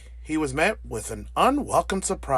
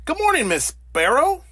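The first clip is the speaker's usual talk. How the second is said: bright, high polite hello, much higher; polite greeting; 11 semitones higher, 4 dB louder than usual. Acted. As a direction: sweetly greet the sparrow. Bear greeting sparrow politely.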